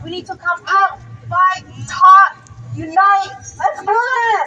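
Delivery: rousing rally shout; rallying cry. Real, not acted. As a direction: fire up the crowd. Rally speaker rousing the crowd.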